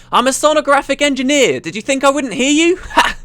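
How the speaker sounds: high-pitched boastful shout. Acted. Sonographic engineer taunting the intruder, shouting. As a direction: shout it over the din, ending on a scornful ha. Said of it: "a voice shouted from up ahead".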